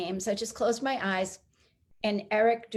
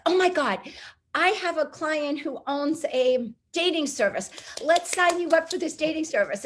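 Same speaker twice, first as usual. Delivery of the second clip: higher-pitched retold gush; excited friend; 6 semitones higher than usual. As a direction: imitate an eager friend making an offer. Retold friend's eager offer.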